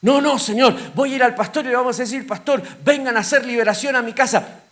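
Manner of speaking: high, loud, frantic protest to God; pleading believer. Acted. Worried believer arguing with God about his family, high and pleading. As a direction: plead desperately, voice pushed high.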